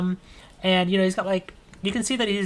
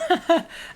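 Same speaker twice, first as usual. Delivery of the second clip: breathy nervous chuckle; nervous laugh; 13 semitones higher than usual. Acted. Marty laughing nervously at gun-toting friend. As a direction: laugh off the tension. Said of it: "he's nervously laughing".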